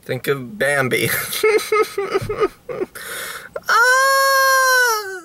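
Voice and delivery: high, squealing fake sob; fake crying on camera. Trying to cry on camera, high fake sob. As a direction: force out a theatrical cry. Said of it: "I'll cry on camera".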